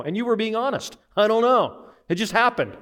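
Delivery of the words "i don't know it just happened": high-pitched mock helpless answer; higher, insistent acted excuse; clueless protest. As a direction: play a kid with no explanation for mama. Child answering mama's why, acted.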